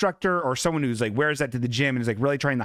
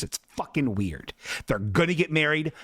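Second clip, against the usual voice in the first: usual speaking voice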